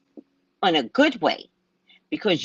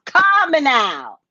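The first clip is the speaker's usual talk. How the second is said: a lightly put-on Caribbean psychic call, Calm now drawn out and louder; Miss Cleo accent; 7 semitones higher, 6 dB louder than usual. Shown as a demonstration. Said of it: "I've been practicing all day".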